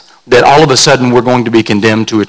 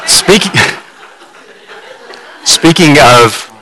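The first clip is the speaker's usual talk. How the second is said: laughing through words; 3 semitones higher than usual. Real, not acted. Laughing while restarting sentence.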